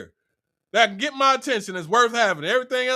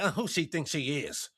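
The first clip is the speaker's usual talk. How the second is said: put-on catty hater girl; 5 semitones lower than usual. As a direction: sneer like a jealous girl in the corner. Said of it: "another little crew of females be mad".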